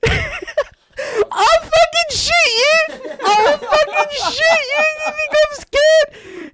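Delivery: shrieked, very high, slow mock shout; hammed-up kid threat. Boy aiming a Nerf gun at a man, hammed-up high shriek. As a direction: squeal it like an overwrought little boy.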